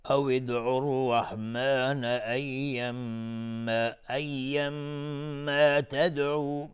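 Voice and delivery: slow, drawn-out recitation of the phrase; recited demo. Shown as a demonstration. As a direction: chant the connected reading slowly. (تلاوت آهسته و کشیده)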